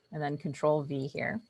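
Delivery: ordinary talk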